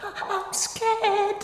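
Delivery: terrified little cry from the hiding place; scared. Acted. Children hiding from the cook.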